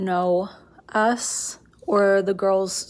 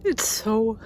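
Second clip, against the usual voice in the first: crying; 3 semitones higher, 6 dB quieter than usual. Real, not acted. Grieving at the dogs' empty yard.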